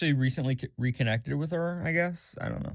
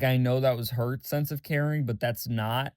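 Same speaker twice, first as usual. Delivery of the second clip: ordinary delivery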